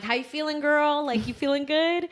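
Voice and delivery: high, bright hype-up greeting; hyping up. Retold or imitated. Mother hyping up the OB as she walks in, excited. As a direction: pump up the doctor like a friend. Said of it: "You're, like, hyping everyone up".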